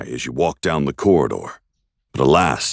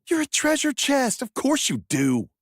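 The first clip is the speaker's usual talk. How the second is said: high hero voice; 4 dB quieter than usual. Acted. Hero arguing with chest.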